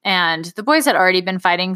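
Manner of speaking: usual speaking voice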